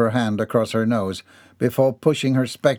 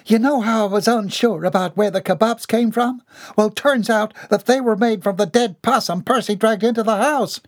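higher, indignant tattling cook; accusing cook; 11 semitones higher, 3 dB louder than usual. Acted. The cook Felinda accusing Bobby Sue, higher. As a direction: tattle on her with outrage.

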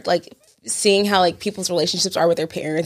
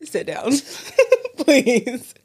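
laughing dismissal; 5 semitones higher, much slower than usual. Real, not acted. Telling dad off, laughing.

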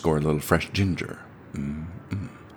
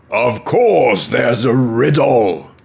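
much higher, louder, slow roaring wolf; wolf's roar; 8 semitones higher, 12 dB louder, much slower than usual. Acted. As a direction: bellow it out slow like an offended beast. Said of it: "roared the wolf".

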